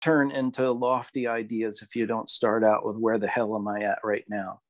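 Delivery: ordinary talk, nothing marked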